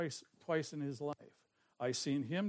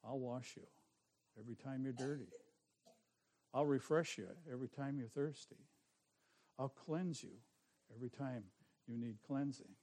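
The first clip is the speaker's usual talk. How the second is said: hushed, softer promise from God; 3 semitones lower, 8 dB quieter than usual. Retold or imitated. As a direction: speak God's promises softly. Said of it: "He says".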